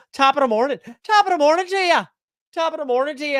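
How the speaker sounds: high, fast hammed-up Irish "top of the morning"; mock Irish accent. Streamer imitating Ron for chat in a hammed-up Irish accent. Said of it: "Ron says just".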